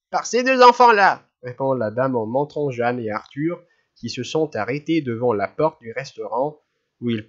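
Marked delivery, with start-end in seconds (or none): 0.1-1.3 put-on accusing old lady. A narrator's reading.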